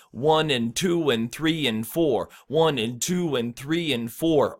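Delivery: slow steady count, "and" light between the numbers; stressed numbers, unstressed "and". Shown as a demonstration. Coach practices beat with learners, slow rhythmic count. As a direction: land on "one", "two", "three", "four", evenly spaced with a light "and". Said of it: "put unstressed syllables in between".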